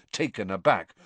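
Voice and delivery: plain reading voice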